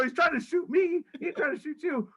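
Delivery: high, insistent mock protest; retold heated protest. Arguing with the driver that he's the target, high heated protest. Retold argument over paintball in van.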